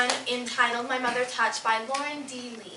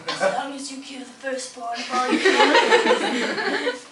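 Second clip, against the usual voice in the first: slow, drawn-out underworld god voice swelling louder; Hades impersonation; 6 dB louder, slower than usual. Acted. Hades answering Iris about her priest, mock menacing. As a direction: make a grim bargain in a put-on god voice. Imitates Hades setting grim condition.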